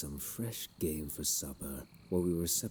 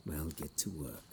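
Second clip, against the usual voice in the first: normal talking voice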